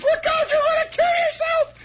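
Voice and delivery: high, loud mock shriek; hammed-up panicked scream; alarmed mother. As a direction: play a mother shrieking a warning. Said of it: "and she says".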